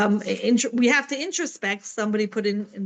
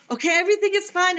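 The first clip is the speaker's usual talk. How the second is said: quick, higher, breezy show of calm; fake calm reassurance; 7 semitones higher, faster than usual. Mother telling her children during the war, mock-breezy reassurance. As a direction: pretend all is well, rattled underneath. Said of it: "I'm gonna tell them".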